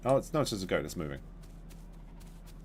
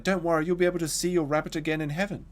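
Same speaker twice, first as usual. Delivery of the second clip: higher, gentle imitation of a comforting mum; soothing mother; 8 semitones higher than usual. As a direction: mimic a mother consoling a child, a touch sugary. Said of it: "And she was like".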